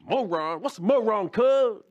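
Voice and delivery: hammed-up gangbanger baffled by the word moron; confused gangbanger. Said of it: "He said".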